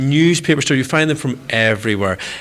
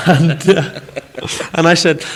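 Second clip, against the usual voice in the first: ordinary talk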